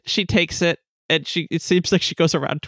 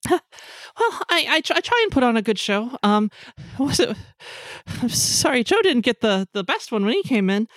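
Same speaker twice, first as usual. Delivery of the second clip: lightly voiced singer, higher pitched; flustered modest singer; 5 semitones higher than usual. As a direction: play a performer brushing off praise, pitched up. Game master plays singer B.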